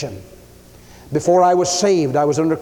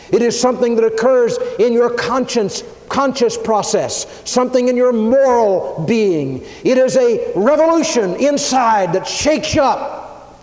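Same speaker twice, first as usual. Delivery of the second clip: fervent preaching; 6 semitones higher than usual. Acted.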